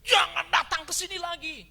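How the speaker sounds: high, very loud shouted rebuke; angry scolding shout. Retold or imitated. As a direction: imitate a furious telling-off at full volume. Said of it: "Dimaki-maki, dimarahin, dia bilang".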